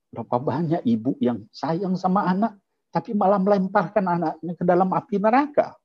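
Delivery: high, rising preacher's rebuke; fiery preaching. Acted. Preacher rebuking parents who neglect religion, fiery preaching. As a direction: drive it home to the congregation.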